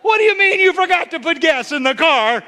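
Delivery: hammed-up spouse fed up about the gas; mock exasperated spouse. Pastor acts out a spouse scolding over the empty tank. Acted married quarrel.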